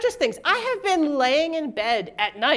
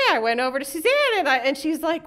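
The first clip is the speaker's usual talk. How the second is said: ordinary talk, nothing marked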